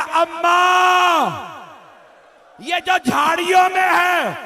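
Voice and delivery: high, loud wail with a long-held call; shouted mourning lament; anguished cry. Acted. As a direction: cry out to a mother, stretching the first word. Slain son cries out to mother.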